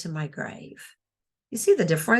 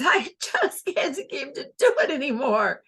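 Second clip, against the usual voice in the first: higher, laughing through every word; laughing the line; 6 semitones higher than usual. Shown as a demonstration. Teacher shows a character laughing the whole line, higher and laughing. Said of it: "the way she said it was".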